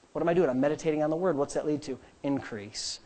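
ordinary delivery

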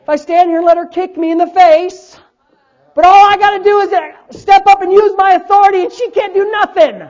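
high, loud, hammering sermon; fired-up preaching. Real, not acted. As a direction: preach hard, punching the key phrases. Preaches at full force.

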